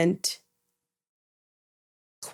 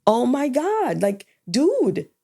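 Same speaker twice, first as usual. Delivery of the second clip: exasperated; 6 semitones higher, slower than usual. Retold or imitated. Retelling her frustration at father.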